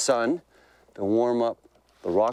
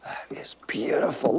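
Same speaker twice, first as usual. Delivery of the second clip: hushed whisper of awe; 5 semitones higher than usual. Real, not acted. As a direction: breathe it out in a whisper. Admiring the caught bass.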